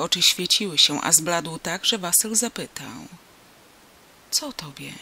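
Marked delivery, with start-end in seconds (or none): 4.3-4.9 whisper. A narrator's reading. Frightened boy asks pale Antoni.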